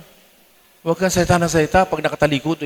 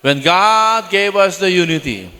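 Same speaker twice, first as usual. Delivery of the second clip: slow, deliberate preacher's emphasis; slow preaching; 3 semitones higher, 3 dB louder, much slower than usual. Real, not acted. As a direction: stretch each word out for weight.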